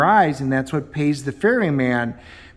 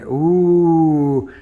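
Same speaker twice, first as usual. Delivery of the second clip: mock spooky ooh; 4 dB louder than usual. Mocking psychic vision ad.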